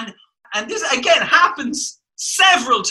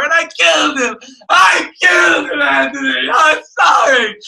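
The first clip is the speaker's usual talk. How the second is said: mock tearful shouting, much higher and louder; over the top confession; 10 semitones higher, 6 dB louder than usual. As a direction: overact a sobbing melodramatic confession. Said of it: "so over the top and so unearned".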